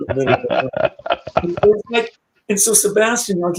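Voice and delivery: ordinary talk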